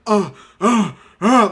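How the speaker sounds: loud straining mock grunts; Broly grunts. Host imitating an anime transformation, straining grunts. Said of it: "like Broly. You know how when Broly transforms".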